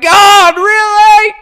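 high, loud, hammed-up disbelief; exasperated cry. Churchgoer facing a long restaurant wait, exasperated shout to God. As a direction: wail it up to heaven. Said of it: "you're gonna be like".

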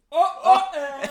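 laughing. Real, not acted. Laughing at the beer label.

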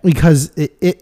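ordinary delivery

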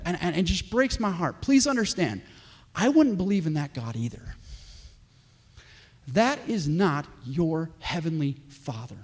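ordinary talk, nothing marked

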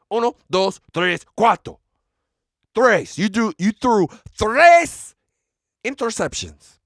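punchy Spanish count-off. Acted. Mocking the player's interceptions, keeping the rapper voice going. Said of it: "still doing the, the voice".

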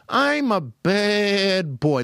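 put-on high, slow naughty-boy wail; Lou Costello impression. Cartoon boy from the lion's belly, slow high wail. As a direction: draw it out, swooping up then down. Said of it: "you hear his voice coming from there".

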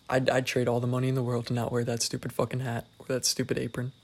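usual speaking voice

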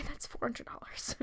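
slips "four hundred dollars" in a soft, unvoiced aside; mock whisper. Mocking the medium charging grieving families, whispered. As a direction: whisper the price like a sly con.

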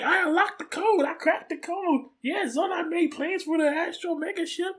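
high-pitched cartoon helper voice; excited Alpha quote. Alpha announcing the plans he made, high put-on voice. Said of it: "He's like".

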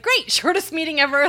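flustered mock cheer with a laugh; embarrassed. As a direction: blurt it brightly to cover embarrassment. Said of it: "like, super embarrassed".

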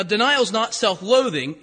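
ordinary talk, nothing marked

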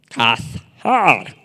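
"Cazar" slowly, in two syllables with a breathy H; Hebrew-style pronunciation. Shown as a demonstration. Preacher quizzes the group, "Cazar" with long H and stressed ending. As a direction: stretch "Cazar" as cah-HAR, end stressed. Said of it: "con una H en medio".

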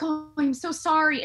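imitated apology. Woman imitating boyfriend apologising after digs, mock-apologetic. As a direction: play his apology a little overdone.

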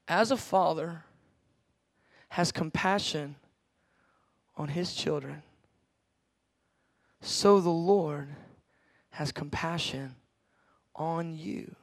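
slow, hushed recitation of scripture; tender sermon reading; soft scripture reading. Acted. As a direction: let the verse settle quietly over them. Preaching scripture softly.

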